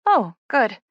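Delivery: a touch of uneasy relief; relief mixed with dread. A narrator's reading. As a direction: sound relieved, with mild dread underneath. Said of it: "with a mix of relief and dread".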